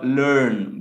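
"লার্ন" said "lurn", with a central vowel; correct LEARN. Shown as a demonstration. Teacher asks class to repeat, careful pronunciation. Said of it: "it's ল, ল".

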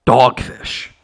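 lightly voiced Gargamel; imitating Gargamel. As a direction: spit it like a villain.

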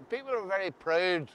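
usual speaking voice

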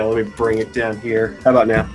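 ordinary delivery

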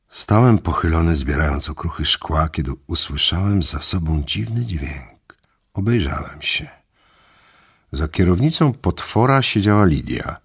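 usual speaking voice

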